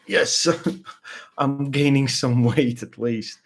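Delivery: a pleased yes breaking into a laugh; laughing delight. Retold or imitated. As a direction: laugh at your own weight gain. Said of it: "I'm like".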